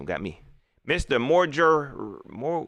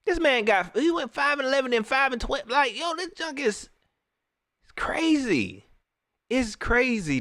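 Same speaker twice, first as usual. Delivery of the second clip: incredulous; 10 semitones higher than usual. Real, not acted. Ranting in disbelief at record.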